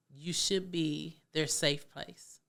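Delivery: ordinary talk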